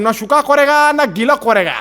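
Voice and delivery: "શુકા" and "કોરેગા" said with o for a, the couplet garbled; Nepali watchman mangling. Retold or imitated. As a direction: recite it proudly with mispronounced words. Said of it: "આણે ચાલુ કર્યું".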